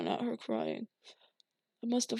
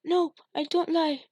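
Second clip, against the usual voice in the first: put-on high, loud child pleading; little girl begging her father; terrified begging; 8 semitones higher, 4 dB louder than usual. A narrator's reading. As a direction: beg for mercy. Polly pleading.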